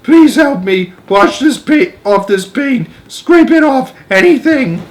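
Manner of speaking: very high, loud pleading; begging centipede. A narrator's reading. Centipede begging James to scrape off the paint. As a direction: beg desperately for rescue. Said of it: "bawled the centipede".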